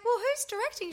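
high squeaky question; mock own voice. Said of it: "And I was like".

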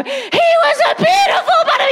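very high squealed reading, rushed out; over-the-top excited story climax. Retold or imitated. Mother skips to the last page for her baby, hammed-up excitement. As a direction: shriek the big reveal in mock rapture.

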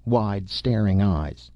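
ordinary delivery, nothing marked

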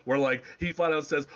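usual speaking voice

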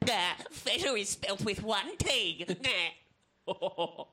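high, drawn-out mocking voice; mock pedantic. Customer correcting pub owner's spelling, mock pedantic. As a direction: put on a silly, high know-it-all voice.

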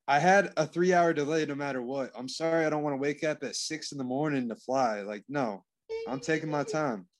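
usual speaking voice

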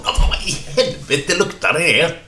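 very high, squeezed exclamation of disgust; appalled outburst. Acted. Rescuer giving mouth-to-mouth, appalled by the smell. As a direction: recoil in hammed-up revulsion.

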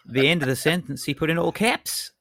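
high, loud mock Australian uptalk; Australian rising inflection. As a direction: imitate an Aussie whose voice climbs at the end. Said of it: "'cause he'd gone up".

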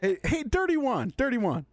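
teasing call. Real, not acted. Calling out to Juan teasingly.

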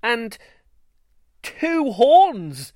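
high, loud shocked gasp of a line. A narrator's reading. Mother shocked to see two horns, gasping. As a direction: gasp it out in disbelief. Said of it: "she gasped".

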